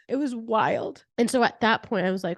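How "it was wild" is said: ordinary talk, nothing marked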